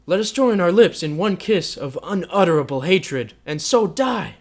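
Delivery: loud, high, furious tirade; wild, high-pitched fury; raging passion. A narrator's reading. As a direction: rail at her without restraint. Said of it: "beside himself with passion".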